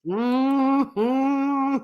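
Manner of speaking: long, high imitated cow moan; drawn-out mock cow groan; cow giving birth. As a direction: moo like a cow in labour. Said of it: "sounded like a cow giving birth".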